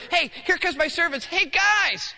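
high, loud hail; calling out. Acted. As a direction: shout to servants coming down the road. Father calls to approaching servants.

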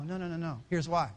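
ordinary talk

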